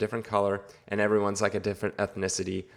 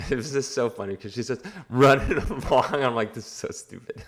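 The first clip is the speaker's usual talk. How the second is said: talking through laughter; real laughing; 3 semitones higher than usual. Real, not acted. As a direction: crack up while telling it, voice giving out into laughs. Cracking up at absurd film scene.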